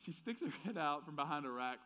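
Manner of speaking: ordinary talk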